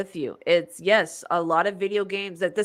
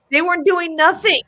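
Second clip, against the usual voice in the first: much higher and louder, exasperated; indignant outburst; 9 semitones higher, 8 dB louder than usual. Retold or imitated. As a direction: burst out defending the villagers. Ranting quote to best friend.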